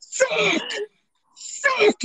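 high-pitched imitated cursing; mock yell. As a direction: yelp it out high like mom caught off guard. Mom yelling after being grabbed.

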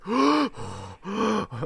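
hammed-up, heavy gasps for air; mock out-of-breath gasping; exaggerated panting. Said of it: "panting like they just ran a fucking marathon".

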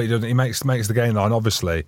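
ordinary talk, nothing marked

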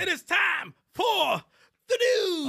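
slow, drawn-out high shout; announcer shout. Acted. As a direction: bellow it like a ring announcer. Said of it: "He was even louder and everything".

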